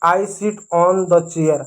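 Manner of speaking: very slow, each word set apart; slow model sentence. Shown as a demonstration. Teacher modelling pronunciation for children, very slow. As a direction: spell it out slowly for beginners. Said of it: "अब इसका आप उच्चारण कैसे करेंगे?".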